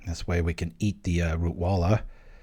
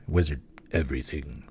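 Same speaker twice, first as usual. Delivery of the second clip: a touch of mock doom, pitched lower and quieter; playful spooky voice over a card reveal; ominous tones; 6 semitones lower, 4 dB quieter than usual. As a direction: intone it like a dark omen, lightly. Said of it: "It's like ominous tones".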